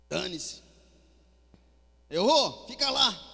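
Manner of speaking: loud, scornful brush-off; mock harsh dismissal. Preacher mocks a God who says fica lá, scornfully. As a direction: throw it in their face, mockingly. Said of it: "não joga na nossa cara assim".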